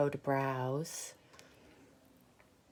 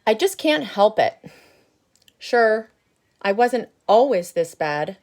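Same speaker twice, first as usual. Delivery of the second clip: loud, higher, grumbling seed voice; grumpy bad seed; 4 semitones higher, 10 dB louder than usual. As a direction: boast about being bad, loud. Seed tells his backstory.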